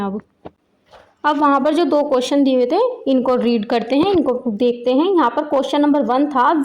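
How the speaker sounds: ordinary talk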